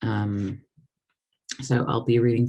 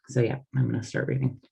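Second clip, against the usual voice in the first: ordinary delivery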